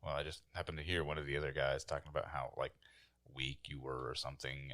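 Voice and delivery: ordinary delivery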